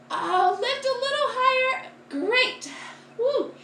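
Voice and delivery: high, bright encouragement; excited cheering. Real, not acted. Cheering kids in the pose.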